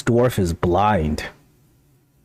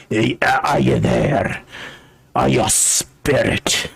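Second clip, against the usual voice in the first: mad blind dwarf, threatening. Acted. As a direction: challenge an unseen stranger as a crazed old dwarf.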